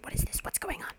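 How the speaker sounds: hushed retold whisper. Relative whispering at a family Seder. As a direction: whisper it under the grown-ups. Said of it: "starts to whisper to my aunt".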